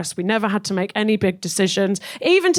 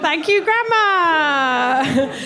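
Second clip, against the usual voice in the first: slow, drawn-out laughing thank you; slow sardonic thanks through a laugh; sarcastic thanks; 5 semitones higher, much slower than usual. Acted. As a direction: drag it out, mock-grateful. Sarcastic thanks to absent grandma.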